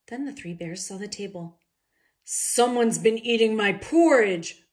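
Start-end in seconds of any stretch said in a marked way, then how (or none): 2.2-4.6 slow, loud bear roar; mild roar. A narrator's reading. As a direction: roar it like an angry papa bear. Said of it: "roared Papa Bear".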